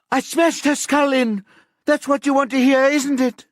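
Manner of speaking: very high, loud furious scream. A narrator's reading. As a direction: scream it at them, past breaking. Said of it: "Vicky screams".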